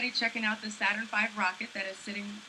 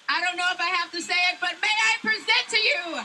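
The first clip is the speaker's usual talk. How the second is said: big hype announcement, loud and high; announcer shout; 10 semitones higher, 5 dB louder than usual. Real, not acted. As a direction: announce it like a ring MC. Hosting announcement to crowd.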